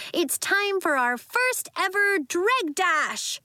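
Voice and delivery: high, slow, grand announcing; excited announcement. A narrator's reading. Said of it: "Nori cried".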